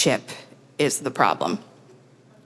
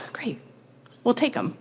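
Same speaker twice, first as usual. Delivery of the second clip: much softer, dry and defeated; deadpan resignation; 4 semitones higher, 7 dB quieter than usual. Retold or imitated. Bleeding, to the owner, deadpan resigned acceptance. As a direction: say it flat through the pain.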